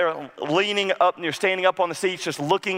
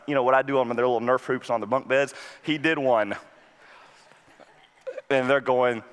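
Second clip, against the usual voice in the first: usual speaking voice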